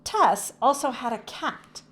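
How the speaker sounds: ordinary delivery, nothing marked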